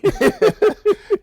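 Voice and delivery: short, loud, high laugh; high, bouncing chuckle at full volume; amused laugh. Real, not acted. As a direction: burst out laughing at your own quip. Laughs at own quip.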